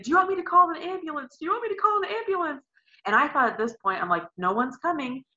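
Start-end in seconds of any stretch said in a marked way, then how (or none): 0.0-2.6 worried mom. Retold or imitated. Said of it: "And she just kept saying".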